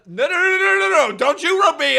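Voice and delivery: loud protest. Real, not acted. Protesting being called dumb.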